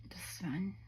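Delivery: soft whispered pointing; quiet whisper of admiration. Real, not acted. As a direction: whisper it to the camera. Hushed while showing cards.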